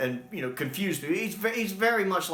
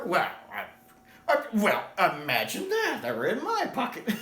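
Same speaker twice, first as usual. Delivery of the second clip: high, sheepish mock surprise; feigned surprise; 8 semitones higher than usual. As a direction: act caught out, high and innocent.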